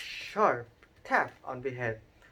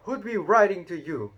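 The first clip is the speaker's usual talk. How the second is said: sneering; 6 semitones higher, 8 dB louder than usual. A narrator's reading. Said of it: "sneered Uncle Vernon".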